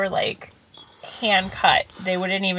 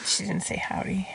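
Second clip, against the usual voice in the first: unvoiced, much softer correction; whispered aside; 7 dB quieter than usual. Real, not acted. Wife whispers a correction during his impression. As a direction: whisper it to the side.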